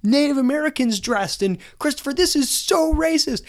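hammed-up protester outrage; mock outraged protesters. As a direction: shriek it like scandalised protesters. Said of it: "they're all yelling".

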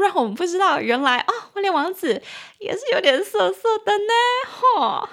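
high, cutesy mock-scandalised voice; cheeky high-pitched gossip tone; playful teasing. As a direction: tease coyly, pitch climbing. Teases prince as naughty.

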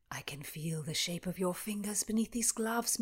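intimate whisper, no voice at all; hushed, secret whisper of a suitor. Acted. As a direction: breathe it close to her ear. Escort whispering through helmet.

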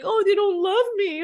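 high, hammed-up self-pity ending in a whimper; mock wounded whine. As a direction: wail it like a rejected child, clearly put on. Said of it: "If I made it mean".

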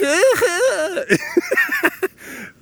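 high laughter. Real, not acted. Laughs after impression.

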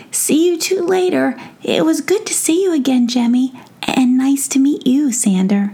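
cheery little gnome; gnome boy voice. Acted. Tom bids farewell.

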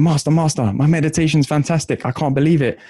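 high, gushing, thrilled student bursting in; mock scream of pure delight; excited student. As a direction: rush in overjoyed, voice pitched high.

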